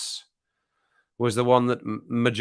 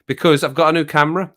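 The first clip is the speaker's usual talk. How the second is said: normal talking voice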